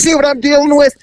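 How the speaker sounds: high, mock-aggrieved appeal; exasperated complaint. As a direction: imitate him pleading his case to the officer. Retold man complaining about her.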